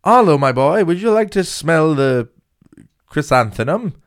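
put-on posh accent, slowing grandly toward the end; mock posh accent. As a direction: play a hammed-up posh dad addressing his boy. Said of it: "Where's he getting this fucking accent from?".

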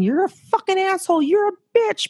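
high mock insult yelling; mock fight. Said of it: "start throwing things".

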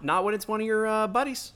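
normal talking voice

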